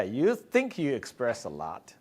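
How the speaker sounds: ordinary delivery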